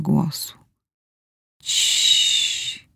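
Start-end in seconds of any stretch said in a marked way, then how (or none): 1.6-2.9 soft shushing whisper; whispered hush. A narrator's reading. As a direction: hush him gently in a whisper. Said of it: "wyszeptałam". (szeptem, uciszająco)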